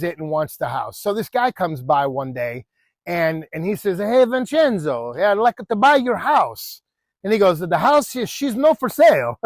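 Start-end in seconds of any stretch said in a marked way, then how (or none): 4.0-6.8 put-on botched Italian accent. Buyer asking Vincenzo for his house, Italian accent. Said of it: "if I'm botching it". 7.6-9.4 high-pitched mock Italian homeowner; botched Italian accent. Homeowner refusing to sell, in a botched Italian accent. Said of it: "if I'm botching it".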